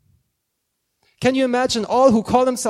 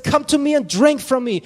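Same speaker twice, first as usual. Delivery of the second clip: louder, higher calling out; mock yell; 5 semitones higher, 6 dB louder than usual. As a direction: call the invitation out like a yell. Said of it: "he yelled and he said".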